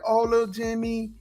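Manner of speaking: high, soft goody-goody plea; mock-polite high voice. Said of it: "he not gonna be like".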